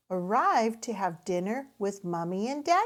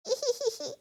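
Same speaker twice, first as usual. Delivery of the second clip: giggle. A narrator's reading. Characters laugh.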